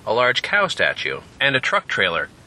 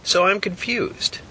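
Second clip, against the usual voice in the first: put-on puzzled young man; 11 semitones higher than usual. Jack voiced by narrator.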